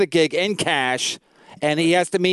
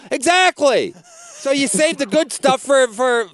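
retold yelling; 6 semitones higher than usual. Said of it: "I was yelling at him".

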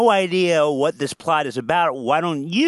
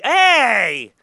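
shout; 7 semitones higher, 7 dB louder than usual. Acted. Calling out at Gino.

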